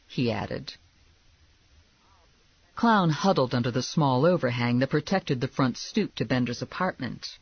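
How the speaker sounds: ordinary delivery, nothing marked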